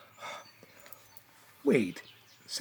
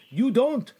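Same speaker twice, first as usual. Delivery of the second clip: ordinary talk, nothing marked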